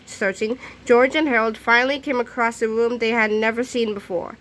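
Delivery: usual speaking voice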